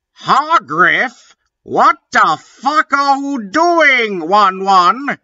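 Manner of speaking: high, loud outraged yell; shouting. A narrator's reading. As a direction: scream it at him, high. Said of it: "he shouted".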